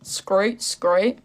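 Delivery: practice word in a rough Aussie accent; Australian accent. Shown as a demonstration. Streamer getting into character, Australian accent attempt. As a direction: try out an Australian vowel. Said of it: "That's how I get into my Australian accent".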